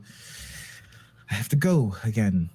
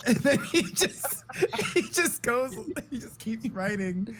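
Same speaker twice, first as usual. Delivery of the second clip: laughing; 9 semitones higher, 4 dB quieter than usual. Real, not acted. Laughing while telling.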